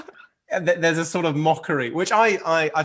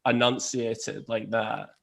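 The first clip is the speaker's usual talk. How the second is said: slow, carefully enunciated and held high in the throat; enunciated Murray voice; 2 semitones lower, 6 dB quieter, slower than usual. Shown as a demonstration. Guest demonstrates the slower, enunciated Andy voice. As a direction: lean into each syllable slowly, throat-placed. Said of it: "slightly higher up in the throat".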